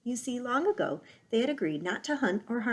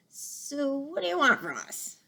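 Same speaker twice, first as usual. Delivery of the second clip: growl; 6 semitones higher than usual. Acted. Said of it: "growled Fink".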